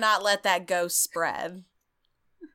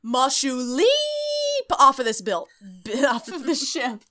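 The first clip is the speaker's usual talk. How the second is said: much higher, a mock heroic cry; hammed-up dramatic exit; 4 semitones higher, 4 dB louder than usual. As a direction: declaim it like a melodrama leap. Joking dramatic exit from ship.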